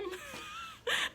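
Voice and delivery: squealing high laughter. Real, not acted. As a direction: burst out in shrieking laugh. Laughing at door frame.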